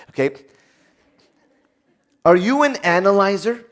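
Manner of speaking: ordinary talk, nothing marked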